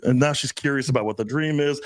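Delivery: normal talking voice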